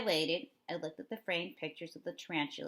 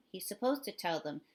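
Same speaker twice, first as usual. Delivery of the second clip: plain reading voice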